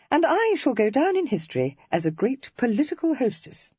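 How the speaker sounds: a hint of a spirited young lady teasing; Cressy. A narrator's reading.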